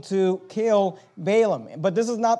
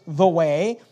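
"way" pressed louder with a rise; stress on way; 4 dB louder than usual. Shown as a demonstration. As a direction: hit "way" hard as you read it. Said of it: "notice the emphasis".

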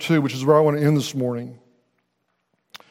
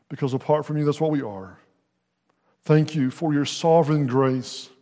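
prayer. Acted. Pastor prays after sermon.

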